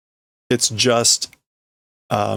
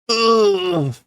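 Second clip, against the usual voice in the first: higher strained cry; pained groan; 7 semitones higher than usual. Acted. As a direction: let out a hurt cry. Pained game sound imitated.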